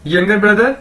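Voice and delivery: normal talking voice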